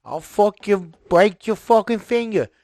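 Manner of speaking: loud, high threatening thug voice; mock tough guy. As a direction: growl a hammed-up threat at the kid. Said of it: "he doesn't sound like that".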